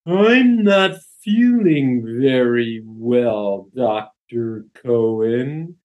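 very slow, drawn-out words; slow motion. Retold or imitated. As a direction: stretch every word out, dazed and slow. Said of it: "it's like he's talking in slow motion".

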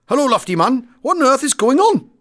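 high, loud Welsh lad on the intercom; Welsh accent. Acted. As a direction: call up in an animated Welsh accent. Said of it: "a young Welsh boy".

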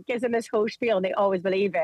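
usual speaking voice

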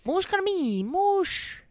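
very high-pitched fake French; terrible French accent. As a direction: put on a bad, hammed-up French accent. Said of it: "That was a terrible French accent".

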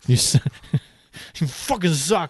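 laughing through a mock Boston heckle; a clumsy, half-laughed Boston accent; bad Boston accent. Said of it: "I don't know what accent that was".

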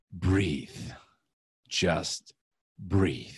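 low, spaced-out soothing words; slow them down, one low word at a time; calming coach. Retold or imitated. Coach calming him in retold session.

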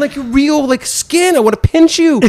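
high, amazed disbelief; awestruck. Retold or imitated. To Guy Fieri, starstruck high amazed cry. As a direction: gasp it out like a cartoon came alive.

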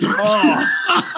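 big real laugh at the punchline; cracking up at his own story; loud burst of laughter. Real, not acted. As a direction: laugh out loud, high and hearty.

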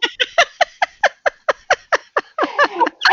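long high laugh. Real, not acted. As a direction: burst into laughter. Mom laughing at family story.

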